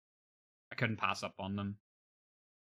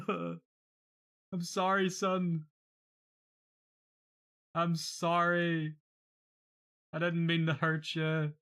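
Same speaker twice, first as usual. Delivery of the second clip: high, slow, laughing through the sorry; milk the fake grief; mock tearful apology; 7 semitones higher, much slower than usual. Hammed-up sorrow apologising to Son, slow.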